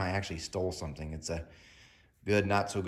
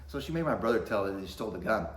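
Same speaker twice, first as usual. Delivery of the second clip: normal talking voice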